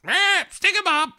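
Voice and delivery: very high squeaky bear voice. Retold or imitated. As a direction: squeak it out like a silly cartoon bear. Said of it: "And the bear goes".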